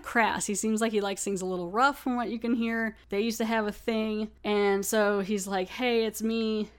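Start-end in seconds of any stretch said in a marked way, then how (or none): none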